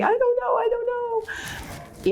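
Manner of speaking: high, stretched, panicky stammer; panicked freeze. Retold or imitated. Answering the marketing person in a high panicked whine. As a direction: play someone frozen in panic. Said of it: "marketing person is like".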